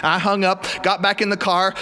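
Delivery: ordinary delivery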